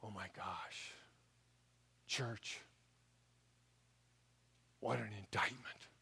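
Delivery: whispered, stunned aside. Real, not acted. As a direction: breathe it out in dismay. Pastor reacts to shocking quote.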